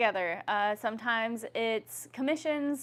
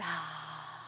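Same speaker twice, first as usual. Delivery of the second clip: loud open mouth sigh; 7 semitones lower, 9 dB quieter than usual. Shown as a demonstration. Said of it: "make it loud".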